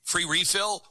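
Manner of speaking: normal talking voice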